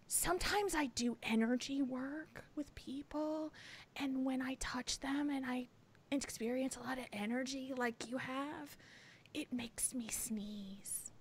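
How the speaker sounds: a hushed masseuse confiding her odd method; hushed breathy imitation. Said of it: "the way she said it too".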